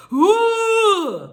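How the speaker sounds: high, loud wild yelp; wild animal cry. Retold or imitated. Acting herself as a wild animal.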